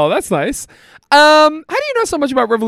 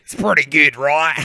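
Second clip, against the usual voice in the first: tough guy Australian; 5 semitones lower than usual. Pretend. Checking if the Aussie voice lands, still in a low Australian accent. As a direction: lower Aussie voice held on. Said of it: "my real tough guy Australian accent".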